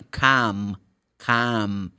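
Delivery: calm said as kʰɑm, silent L; calm without L. Shown as a demonstration. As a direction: say calm with no L sound, like kahm. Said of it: "you have the letter L ... It's not pronounced".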